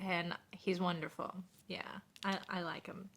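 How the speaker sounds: soft unvoiced whisper; confiding to the chat, whispered. Real, not acted. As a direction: breathe it like a secret. Confiding aside about the streamer.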